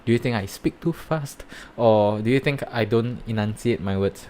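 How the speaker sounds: ordinary talk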